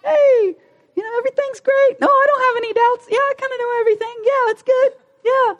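very high, sing-song fake cheer; squeaky mock-pleasant churchgoer; fake church face. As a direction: put on a high, phony everything-is-fine voice. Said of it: "put on the church face like".

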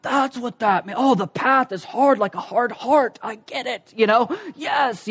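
acted hushed aha moment; softly excited, mock revelation; dawning realization. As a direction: imitate a pupil finally getting it. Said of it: "you can kinda see him going".

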